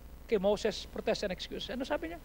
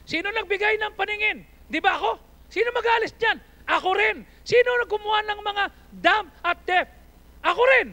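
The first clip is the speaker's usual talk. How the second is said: high, loud thundering questions; full-force preaching; 11 semitones higher, 11 dB louder than usual. Acted. Pastor thundering God's questions at the congregation. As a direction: hurl each question at the crowd.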